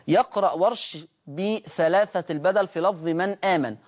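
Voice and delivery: ordinary delivery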